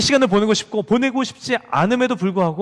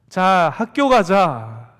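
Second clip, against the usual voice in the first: slow firm call; 5 dB louder, much slower than usual. Retold or imitated. Father firmly sending child.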